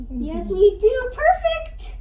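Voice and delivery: building from slow coo to high praise; coo, then praise up high. Real, not acted. Fitter talking sweetly to baby during fitting.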